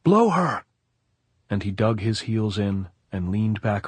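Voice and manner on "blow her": high, loud urgent command; yelling. A narrator's reading. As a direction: yell the order down the line. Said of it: "yelled to Anselmo".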